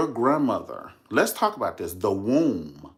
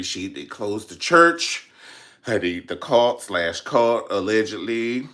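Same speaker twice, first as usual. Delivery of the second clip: ordinary talk, nothing marked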